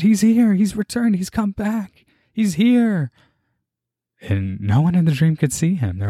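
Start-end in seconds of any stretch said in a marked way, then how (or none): 0.0-3.1 high, urgent announcing; much higher, ringing cries; excited shouting. Retold or imitated. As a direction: proclaim he has returned. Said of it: "as I'm shouting his name".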